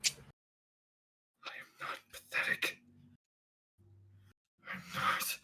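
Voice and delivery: a broken, soft, denying whisper; hurt whisper. Acted. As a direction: deny it under the breath, wounded. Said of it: "he goes".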